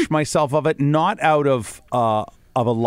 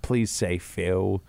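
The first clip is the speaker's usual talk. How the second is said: slow pleading; 4 semitones lower, 6 dB quieter, much slower than usual. Real, not acted. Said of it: "I wish I had an accent".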